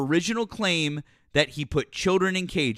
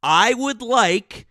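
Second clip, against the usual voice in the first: very slow, each word drawn out; said slower; 2 semitones higher, much slower than usual. Shown as a demonstration. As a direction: spell it out slowly as if to a child. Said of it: "Let me say it slower".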